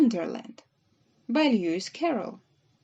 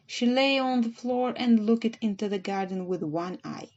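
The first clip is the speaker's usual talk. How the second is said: ordinary delivery, nothing marked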